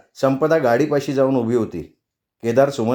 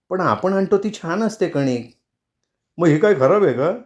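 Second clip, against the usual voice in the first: higher, mildly heated comeback; heated retort; 5 semitones higher than usual. A narrator's reading. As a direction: retort with a touch of indignation. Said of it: "Kedar एकदम तिचं बोलणं ऐकून तावातावात म्हणाला".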